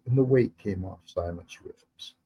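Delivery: usual speaking voice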